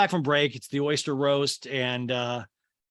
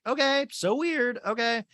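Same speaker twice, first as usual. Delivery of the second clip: mock awkward; 10 semitones higher than usual. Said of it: "Madison's like".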